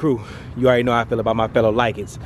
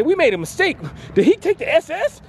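high, worried voice, much higher; panicking dealer asking about the car; mock dealership staff; 13 semitones higher than usual. Imitating the dealer asking if he took the car, higher. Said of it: "They may say".

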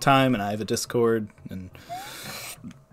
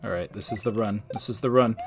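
usual speaking voice

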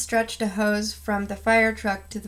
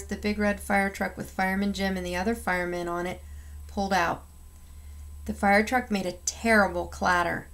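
ordinary delivery, nothing marked

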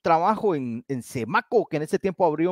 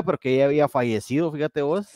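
normal talking voice

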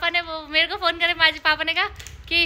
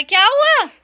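concerned; 7 semitones higher, 6 dB louder than usual. Real, not acted. Crying birthday girl arrives.

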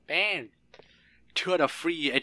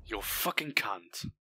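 ordinary talk, nothing marked

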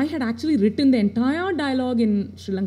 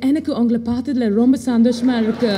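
mock TV presenter's put-on accent, louder; horrible anglicised Tamil accent; 5 dB louder, much faster than usual. TV anchor gushing to viewers, in a horrible put-on Tamil accent. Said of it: "in a really horrible accent".

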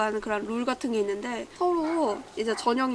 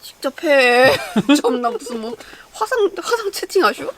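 mock scolding; 3 semitones higher, 5 dB louder than usual.

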